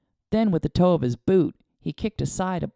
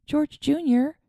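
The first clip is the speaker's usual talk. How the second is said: calling out; 10 semitones higher, slower than usual. A narrator's reading. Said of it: "she called out to him".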